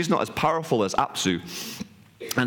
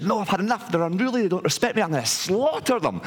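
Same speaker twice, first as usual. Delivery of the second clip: fed-up refusal, pitched higher; retold fury; 7 semitones higher, faster than usual. Father god overrules the kids' mother, retold exasperated fury. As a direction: play a father who has had enough, no shouting. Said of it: "he starts to lose his mind".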